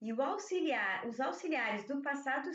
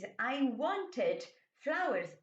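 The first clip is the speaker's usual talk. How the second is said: each word set apart, slowly; slow model sentence; much slower than usual. Shown as a demonstration. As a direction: read it out slowly for the class. Teacher reads past example slowly. (frase de exemplo lida devagar)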